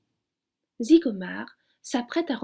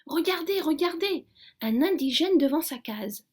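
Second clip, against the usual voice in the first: a lightly raised, high excited call; marvelling cry; 7 semitones higher than usual. A narrator's reading. The blackbird marvelling at everything to his friends. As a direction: call out full of wonder. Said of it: "s'écrie Zigomar".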